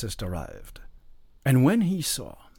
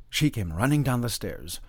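usual speaking voice

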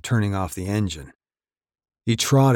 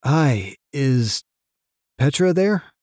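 nervous; 6 semitones higher, 5 dB louder, slower than usual. A narrator's reading. Jake nervous on the phone.

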